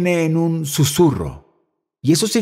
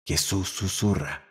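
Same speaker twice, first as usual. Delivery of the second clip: much lower and much softer, near a whisper; soft hushed voice; 8 semitones lower, 12 dB quieter than usual. Shown as a demonstration. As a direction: drop down to a hush as if whispering. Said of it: "Primera diferencia". (voz baja y suave, casi susurro)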